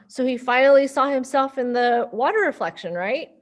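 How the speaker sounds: usual speaking voice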